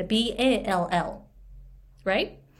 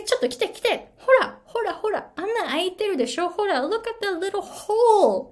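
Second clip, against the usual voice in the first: urgent pointing out; 9 semitones higher than usual. Retold or imitated. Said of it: "って言ったんですね".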